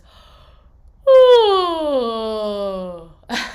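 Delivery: loud sliding collapse, sound falling into the throat; collapsing sound. Shown as a demonstration. Coach showing the wrong collapsed sound, a falling collapse. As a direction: let the voice collapse on purpose. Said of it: "It's going like this".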